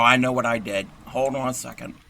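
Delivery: normal talking voice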